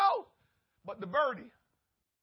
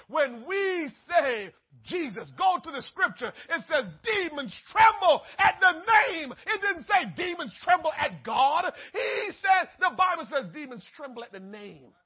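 fiery preaching; 2 semitones higher, 7 dB louder than usual. Real, not acted. Preacher to congregation, loud fervent preaching.

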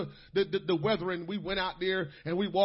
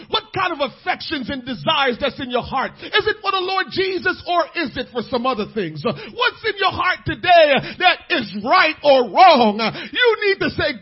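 full-force preaching; 4 semitones higher, 8 dB louder than usual. Acted. Pastor preaching obedience from love to the congregation, full-force. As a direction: drive it home to the pews.

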